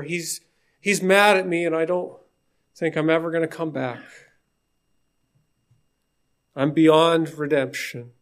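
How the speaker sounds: a touch of hammed-up despair; despairing plea. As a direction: plead like a man who has lost everything. Cain despairs to the Lord.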